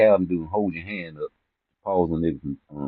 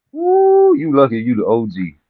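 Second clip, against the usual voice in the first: excited whoop; 6 semitones higher, 7 dB louder than usual. Real, not acted. Outburst at viewer's disrespect.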